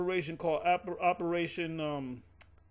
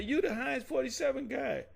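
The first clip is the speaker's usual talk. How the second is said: higher-pitched retold exclamation; excited recognition; 8 semitones higher than usual. Apostles greet ad actor on the street, excited recognition. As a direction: recreate spotting someone from TV, pitched up.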